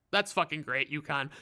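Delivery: ordinary delivery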